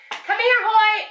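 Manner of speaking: loud, high call across the house; shouting a dog's name from another room. Real, not acted. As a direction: yell for the dog to come. Calling the dog again.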